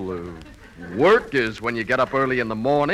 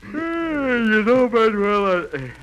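words stretched slowly through a yawn; 7 semitones higher, slower than usual. Acted. [name] yawning mid-sentence at Manuel. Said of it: "I just yawned".

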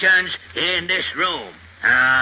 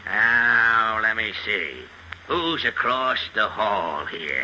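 ordinary delivery

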